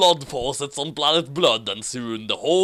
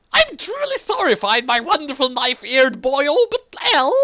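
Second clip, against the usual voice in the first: very high, sing-song ghost voice; spooky high voice; 9 semitones higher than usual. Acted. Ghost speaking on the phone in a high creepy voice.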